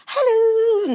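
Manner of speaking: high, put-on posh "Hello". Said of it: "she was like".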